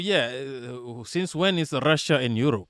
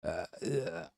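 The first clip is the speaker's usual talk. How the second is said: very low, faint croaks; badly faked creaky "uh, uh"; attempted vocal fry; 9 semitones lower, 16 dB quieter than usual. Streamer failing to speak in vocal fry, faint croaking. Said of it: "I can't even speak like that".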